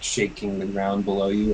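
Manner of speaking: usual speaking voice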